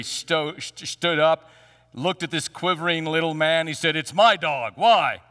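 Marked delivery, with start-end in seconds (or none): 3.9-5.2 loud, challenging biker growl; tough biker. Retold or imitated. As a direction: square up like a big biker. Big biker answers the little man.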